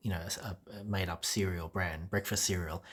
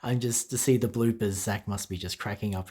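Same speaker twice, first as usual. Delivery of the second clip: usual speaking voice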